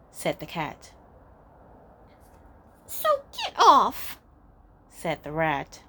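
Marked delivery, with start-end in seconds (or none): 2.9-4.2 high, loud squeaky rat; squeaky angry rat. Acted. Rat orders cat off.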